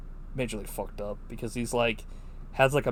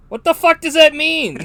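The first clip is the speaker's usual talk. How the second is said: indignant disbelief; 13 dB louder than usual. Real, not acted. Outraged at a movie line.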